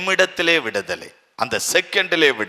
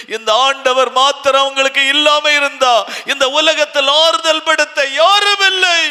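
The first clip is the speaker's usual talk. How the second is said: building higher and louder to a peak; rising preaching; 9 semitones higher, 3 dB louder than usual. Acted. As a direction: drive the comfort home.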